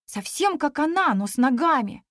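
usual speaking voice

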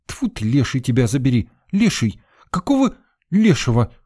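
shrieking. A narrator's reading. Sveta shrieking at the narrator who startled her. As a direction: yelp it out in fright. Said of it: "завопила девчонка".

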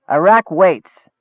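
slow, curt ogre grunt. Acted. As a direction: grunt it out slowly, a brute of few words. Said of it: "Rog grunted".

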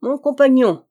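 loud, high address; mock-friendly hail. A narrator's reading. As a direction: call out with false camaraderie. Villain hails his dying rival.

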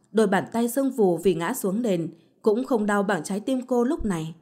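ordinary delivery

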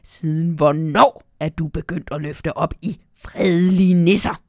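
loud, grumpy elf complaint; grumbling elf. Acted. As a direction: grumble it with indignation. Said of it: "brummer Nisse Pok".

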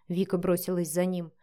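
ordinary delivery, nothing marked

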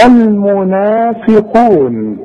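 المنافقون with the alif and waw held two counts; natural madd. Shown as a demonstration. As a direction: stretch each long vowel in المنافقون to two beats. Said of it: "النون عليها فتحة". (مد طبيعي بحركتين في المنافقون)

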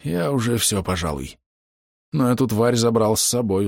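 a hint of a spent, wounded man; wounded archer. A narrator's reading. Dying archer admits he is finished, weakened voice.

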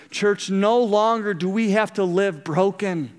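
ordinary talk

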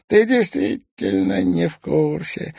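mock the boy from above; villain voice. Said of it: "покачал головой хозяин".

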